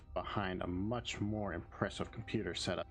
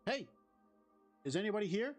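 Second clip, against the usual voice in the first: calling out; 12 semitones higher, faster than usual. Acted. Calling out into empty room.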